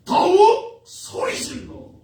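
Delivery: high, loud, drawn-out proclamation; emphatic climax. Acted. Preacher climaxing the blind men's story, loud drawn-out call. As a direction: stretch the words and call them out.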